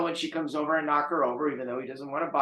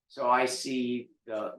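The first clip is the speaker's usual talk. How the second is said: ordinary delivery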